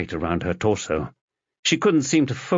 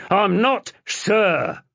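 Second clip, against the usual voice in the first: protesting shout; 11 semitones higher, much slower than usual. Acted. Fenchurch resisting the officer.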